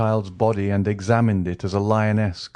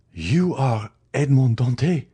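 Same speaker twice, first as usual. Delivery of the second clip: higher cry of recognition, rising on you; stunned cry; 5 semitones higher, 3 dB louder than usual. A narrator's reading. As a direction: cry out in horror at the name. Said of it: "cried Villefort".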